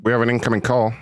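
ordinary talk

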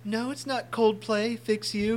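lightly voiced British friend; mock British voice. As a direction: play a puzzled Brit. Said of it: "They go".

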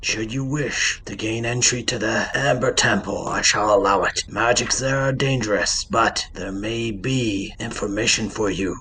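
measured, formal and composed; stately, even-voiced elder cleric; solemn temple figure. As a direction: judge them calmly with grave authority. Game master voices solemn figure.

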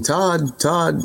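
put-on calling out a name in a British accent, high-pitched; lilting British-accented call for someone. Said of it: "with this British accent".

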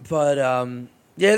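ordinary talk, nothing marked

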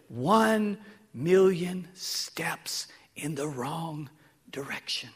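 slow, breathy whisper of a grim secret. Retold or imitated. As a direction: whisper it slowly, like bad news. Historian's grim answer retold.

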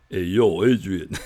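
loud, offhand hey yo; casual street greeting. Retold or imitated. Husband calling his bride casually, loud offhand greeting. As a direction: toss it off like a buddy. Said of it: "He didn't just say".

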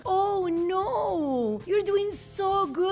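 gushing American. Retold or imitated. American praising a struggling learner, gushing high.

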